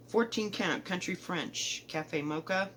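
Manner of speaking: ordinary delivery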